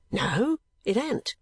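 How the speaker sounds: lightly voiced firm old clerk; positive. A narrator's reading. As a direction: shake it off with great positiveness. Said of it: "with great positiveness".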